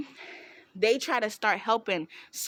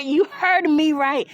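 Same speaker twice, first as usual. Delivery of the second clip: emphatic confirmation; 5 semitones higher, 8 dB louder, slower than usual. Real, not acted. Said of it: "confirming loudly to listener".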